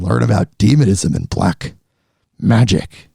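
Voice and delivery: deep, growling demon voice. Pretend. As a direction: drop low into a sinister demon. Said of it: "that was my demon voice".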